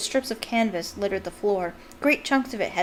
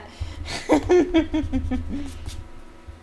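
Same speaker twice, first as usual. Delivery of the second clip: real laugh, rising higher; long amused laugh; 6 semitones higher than usual. Real, not acted. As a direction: let the giggle roll on. Laughing at Percy's pomposity.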